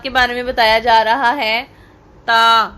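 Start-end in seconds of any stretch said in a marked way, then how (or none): 1.6-2.6 ता said light and thin. Shown as a demonstration. Teacher showing the thin letter ता. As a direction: keep ता thin. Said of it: "ता को हमेशा बारीक पढ़ा जाता है".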